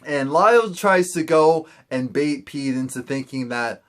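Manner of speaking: normal talking voice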